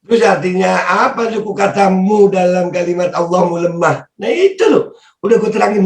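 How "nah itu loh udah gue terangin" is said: exasperated. Real, not acted. Exasperated high exclaim.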